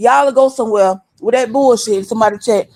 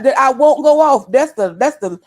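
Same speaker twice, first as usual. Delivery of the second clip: ordinary talk, nothing marked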